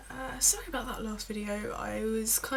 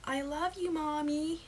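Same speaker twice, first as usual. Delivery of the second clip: put-on high little-girl American voice; thick American accent; 7 semitones higher than usual. Little girl to her mum, high thick American accent. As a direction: imitate an American toddler, pitched high. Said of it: "have a thick American accent".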